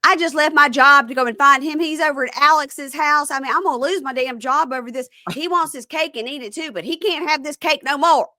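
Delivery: high, loud hammed-up rant; mock ranting Brooke. Brooke on the phone to Bonnie, high hammed-up rant. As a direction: play up her indignant phone rant.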